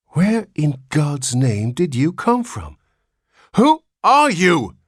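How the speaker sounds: slow, high and loud frantic cry; frantic father demands of the old baby, high and loud. A narrator's reading. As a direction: burst out in panic. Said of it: "burst out Mister Button frantically".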